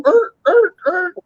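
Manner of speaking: short high-pitched tire squeals; repeated high vocal screech; imitated wheel screech. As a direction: mimic wheels catching the ground in bursts. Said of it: "front wheels would catch the ground and go".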